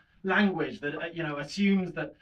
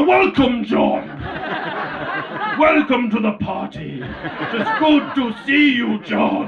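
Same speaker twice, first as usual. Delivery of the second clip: booming, slow, louder welcome; Brian Blessed; 6 semitones higher, 8 dB louder, much slower than usual. Retold or imitated. Welcoming a shy stranger, booming Brian Blessed. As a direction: boom out the greeting like a grand actor. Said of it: "Then I'll turn into Brian Blessed".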